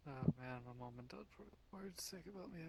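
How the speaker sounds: very soft, private mutter; hushed self-talk; muttering to oneself. Acted. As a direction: mutter under your breath, as if nobody should hear. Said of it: "just muttering to myself".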